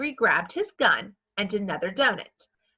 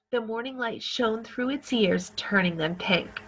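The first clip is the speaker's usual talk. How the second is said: usual speaking voice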